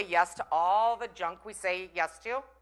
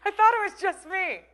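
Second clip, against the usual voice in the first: high amazed roommate; 11 semitones higher, 8 dB louder than usual. Retold or imitated. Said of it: "Jack pipes up and he goes".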